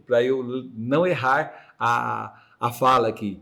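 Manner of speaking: deliberately slow. Real, not acted. Host telling co-host he will avoid errors, deliberately slow. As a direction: take it slowly so as not to stumble. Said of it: "Eu vou falar bem lento".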